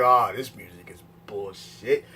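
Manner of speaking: low, slow grumbling mutter; lightly voiced bored critic griping; mock grumble. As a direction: grouse at the music, deep and dragged out. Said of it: "I'm sitting like".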